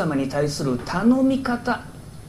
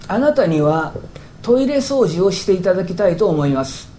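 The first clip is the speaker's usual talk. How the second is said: ordinary talk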